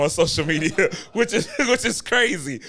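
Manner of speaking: laughing through the words, high and loud. Real, not acted. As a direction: break up laughing as you speak. Amused host remarks on first meeting.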